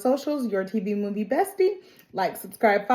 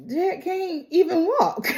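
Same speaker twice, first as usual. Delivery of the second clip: laughing disbelief; 9 semitones higher than usual. Retold or imitated. Team doubting injured Jet.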